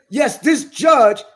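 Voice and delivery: each word laid down slowly; measured, pointed charge; slow, deliberate accusation. Real, not acted. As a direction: spell out willingly, knowingly slowly. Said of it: "I have the right to say".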